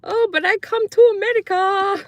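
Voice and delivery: put-on high, eager broken-English child; naive immigrant kid. Young Croatian girl correcting friends, high and naive. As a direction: insist in broken English, innocent. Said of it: "And I'm like".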